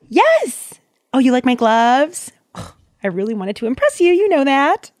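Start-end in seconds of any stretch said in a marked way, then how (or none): 0.1-0.8 much higher, louder, thrilled yes; excited yes. Pretend. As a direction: squeal it delighted. Gasp then excited yes.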